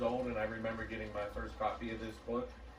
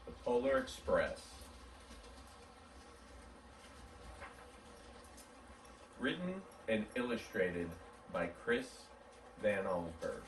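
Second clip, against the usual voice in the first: story narration; 8 semitones higher than usual. A narrator's reading. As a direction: read it like a bedtime story to children. Reads a picture book aloud.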